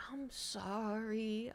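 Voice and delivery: slow, soft, near tears; tearful apology. Real, not acted. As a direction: stretch it out, breaking up. Apologising to cat, tears coming.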